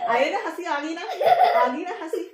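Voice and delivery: high, laughing, drawn-out tease; teasing laugh. Real, not acted. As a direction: giggle while coaxing a smile.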